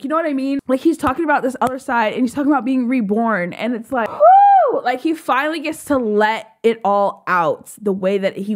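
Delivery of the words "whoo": high, loud "whoo"; exhilarated whoop. Real, not acted. Fan describing him being reborn, exhilarated whoop. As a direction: let out a high-pitched whoop.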